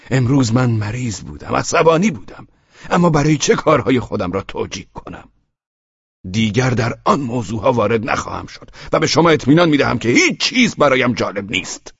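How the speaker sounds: firm, high-pitched insistence. A narrator's reading. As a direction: state it forcefully, pitch up. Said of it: "این جمله را محکم ادا کرد".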